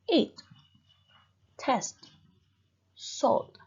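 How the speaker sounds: "ate", "test", "salt" each said whole, with pauses between; one-syllable words. Shown as a demonstration. Teacher shows one-syllable words read whole, separate single beats. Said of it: "you read it one, you cannot separate it".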